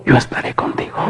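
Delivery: ordinary delivery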